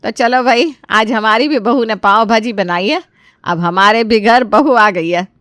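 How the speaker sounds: proud mother showing off; a touch of boastful delight, slowing at the end; happy, showing off. Acted. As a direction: announce it with pleased self-importance. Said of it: "बड़ा ही खुश होकर और इतरा कर कहती है".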